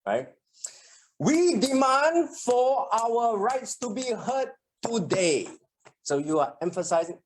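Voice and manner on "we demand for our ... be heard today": higher, slow rally speech; mock political speech, words hammered; emphatic politician. Shown as a demonstration. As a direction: punch each word like a leader at a podium. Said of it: "even politicians do that a lot".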